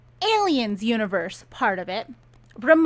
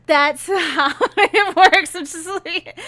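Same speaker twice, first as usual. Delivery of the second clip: laughing; 6 semitones higher, 7 dB louder, slower than usual. Real, not acted. Laughing at absurd plot.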